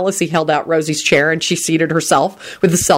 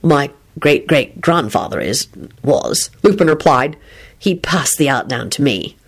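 low male voice; 4 semitones lower than usual. Acted. Lupin low voice.

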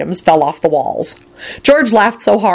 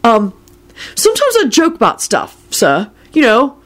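high, halting nervous excuse; wild; 6 semitones higher than usual. A narrator's reading. Charlie blaming his joking to Kingsley, mildly wild. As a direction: scramble for an excuse, pitched up. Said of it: "he added wildly".

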